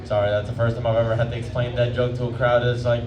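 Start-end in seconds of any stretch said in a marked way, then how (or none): none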